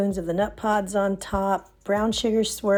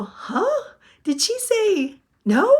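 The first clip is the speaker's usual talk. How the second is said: baffled surprise; 11 semitones higher, slower than usual. Retold or imitated. Confused by instructor's sweet no.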